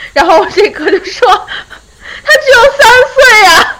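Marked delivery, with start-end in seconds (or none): 1.3-3.7 high, slow laughing retort; laughing disbelief, higher and louder; laughing exasperation. Retold or imitated. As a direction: stretch it out, cracking up. Retelling friend's exasperated retort, laughing.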